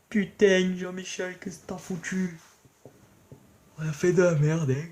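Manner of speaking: high-pitched put-on southwestern French accent; Sud-Ouest accent. Gamer berating Jean-Michel for a blunder, Sud-Ouest accent. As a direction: scold a pal in a hammed-up Sud-Ouest accent. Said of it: "je prends l'accent du Sud-Ouest".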